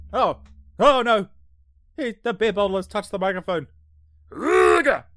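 loud, high panicked yelp; alarmed cry. Real, not acted. Bottle touches microphone.

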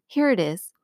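ordinary delivery

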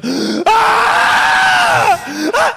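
loud, high sustained scream; imitated scream. Storyteller imitating his friend on the trip, high scream. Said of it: "stop screaming, for fuck's sake".

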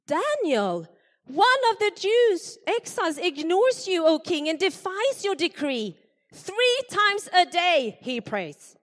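high, loud tattling accusation; accusing conspirators. Acted. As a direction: gleefully tell on Daniel to the king.

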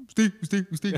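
repeated catchphrase in separate bursts; mock impersonator's routine; Ignacio Santos. Shown as a demonstration. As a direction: parody a one-word impersonation. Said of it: "hágame Ignacio Santos".

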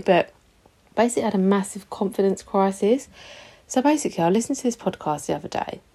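ordinary talk, nothing marked

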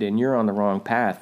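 normal talking voice

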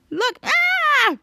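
sudden high-pitched yell to startle; playful scare shout. Pretend. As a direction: shout it high to make someone jump. Sneaking up to scare Charlotte.

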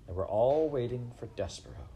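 ordinary delivery, nothing marked

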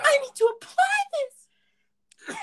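very high, loud squeal, drawn out slowly; high-pitched cartoonish strain; frantic character voice. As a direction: squeal it slowly at the top of your voice. Acting out the story's character.

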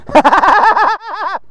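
high rapid-fire cackle; goofy laugh. Real, not acted. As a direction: laugh hard at your own joke. Laughs at own Star Trek joke.

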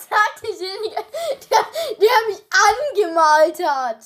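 laughing while answering; giggling through the words. Real, not acted. Friend laughing at painted face.

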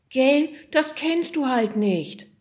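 high, slow, cooing comfort; sympathetic. Acted. Oda comforts the confused yeti, sympathetic. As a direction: soothe it as if to a small child. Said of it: "streichelte Icy mitfühlend den Kopf".

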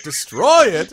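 shocked, loud cry of horror; horrified high shout of disbelief. Acted. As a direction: blurt it out aghast. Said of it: "she kinda has this horrified expression".

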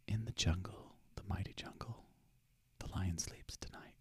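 unvoiced whisper of the lyric; whispered song lyric. Shown as a demonstration. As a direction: mouth the words on breath alone. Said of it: "and whisper it".

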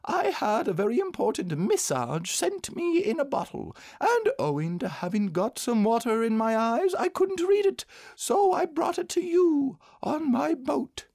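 very high-pitched bear voice; self-important small bear explaining himself; proud little bear. A narrator's reading.

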